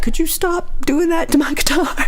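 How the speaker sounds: high-pitched, pleading protest; mock-distressed high plea; exasperated protest. Retold protest over her guitar.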